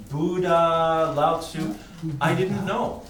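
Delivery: ordinary talk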